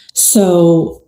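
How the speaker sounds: ordinary delivery, nothing marked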